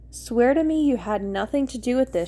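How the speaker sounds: ordinary delivery, nothing marked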